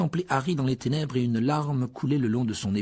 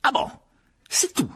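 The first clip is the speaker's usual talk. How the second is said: much higher, louder sarcastic fury; Harry furious at the elf, sharply raised; 5 dB louder than usual. A narrator's reading. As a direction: spit it back in anger. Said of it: "dit Harry avec fureur".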